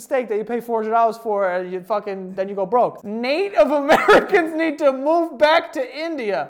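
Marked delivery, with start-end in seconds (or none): 3.1-6.5 laughing loudly through the line. Real, not acted. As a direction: read it out while laughing. Reading dumb comment, laughing.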